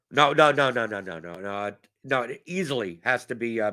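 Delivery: ordinary talk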